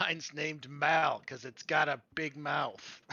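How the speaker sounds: slow, loud little pixie voice. Acted. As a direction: squeak it out slowly like a tiny sprite. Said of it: "your little voice going".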